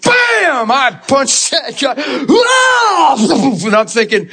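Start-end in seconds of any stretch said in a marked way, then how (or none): 0.0-0.7 mock punch sound. Acting out the punch. 2.3-3.2 shouted cry. Retold or imitated. Reliving the punch.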